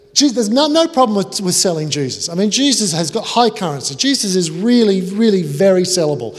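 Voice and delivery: high, loud flustered bluster; hammed-up high, loud drunk guest. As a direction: cover his ignorance with noise.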